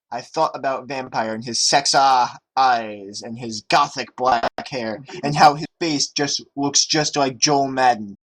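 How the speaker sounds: ordinary talk, nothing marked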